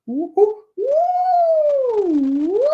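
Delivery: high owl whoops sliding up and down; vocal glide. Shown as a demonstration. Owl flies down to meet class, gliding hoots. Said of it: "I just do a vocal glide".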